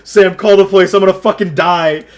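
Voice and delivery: high, loud panicked plea; retold scream. Pleading with worried Sam to get police, screaming. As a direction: re-enact yelling in mortal panic. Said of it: "and I scream".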